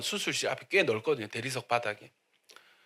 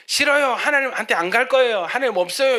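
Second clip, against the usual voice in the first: put-on higher, louder childish refusal; stubborn child; 8 semitones higher, 7 dB louder than usual. Stubborn child refusing God, higher and louder whine. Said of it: "고집 부리는 아기에게".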